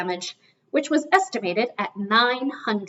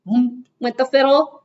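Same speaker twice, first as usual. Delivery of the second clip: usual speaking voice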